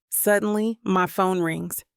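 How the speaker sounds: usual speaking voice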